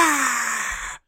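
high, held "ah" of a crowd; mock crowd cheer at normal volume; imitated crowd cheer. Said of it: "and everyone goes".

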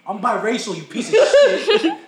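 hammed-up screaming rant; mock yell. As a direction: bellow it like an outraged rapper. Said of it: "He's just gonna yell at you".

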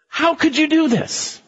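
high, slow horrified question; aghast at Jonah; high, drawn-out disbelief; frightened sailors. Acted. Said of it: "men became extremely frightened".

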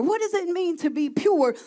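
ordinary talk, nothing marked

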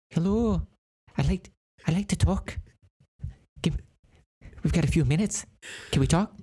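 high motherly nanny voice; high, fussing old nanny; Mrs. Doubtfire voice. Acted. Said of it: "a Mister Missus Doubtfire type of voice".

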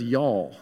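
"y'all" said as one drawled southern syllable, low and falling. Shown as a demonstration. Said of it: "this is where our southern vernacular comes into play".

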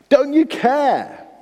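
high, slow pleading wail; helpless cry. Acted. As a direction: stretch it out in helpless despair. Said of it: "they cry out in their helplessness".

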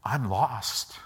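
soft, sincere, broken admission; man admitting to his driver he is lost; quiet confession. Retold or imitated. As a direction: let it fall out quietly. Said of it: "he's like".

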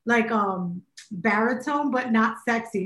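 ordinary delivery

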